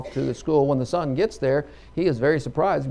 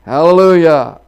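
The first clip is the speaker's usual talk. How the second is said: loud, higher exultant cry; hollered praise; 4 semitones higher, 14 dB louder than usual. Acted. Old farmer on his sins cast into the sea, hollering. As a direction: let it ring out as a big holler. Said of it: "he hollers out".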